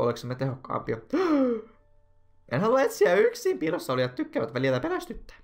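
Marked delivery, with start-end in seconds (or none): 1.0-1.6 sudden high-pitched gasp; acted gasp. Gasp while voicing the game. 2.5-5.4 very high, loud put-on plea; pleading character voice. Character objects to searching alone.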